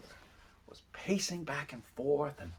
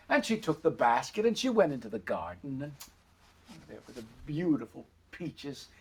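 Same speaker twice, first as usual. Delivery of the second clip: ordinary delivery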